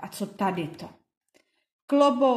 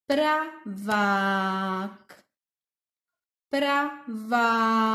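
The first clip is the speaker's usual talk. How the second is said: "pravák" split pra-vák, two claps; clapped syllables; much slower than usual. Shown as a demonstration. Teacher clapping "pravák" with the kids. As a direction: clap it out in beats.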